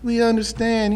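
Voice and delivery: calm a caller on the phone; soft kind helpline voice; gentle understanding. Retold or imitated. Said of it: "He had the nicest voice".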